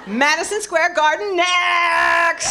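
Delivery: high, drawn-out announcer yell; triumphant mock shout. Speaker boasting to audience after conquering the stage, mock triumphant shout. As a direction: holler it to the rafters, stretching the last word.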